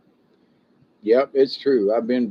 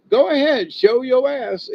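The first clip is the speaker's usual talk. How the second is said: much higher, louder mocking dare; sing-song taunt; 10 semitones higher, 5 dB louder than usual. Repeating the dare to an intruder, high sing-song taunt. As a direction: tease him into making a move.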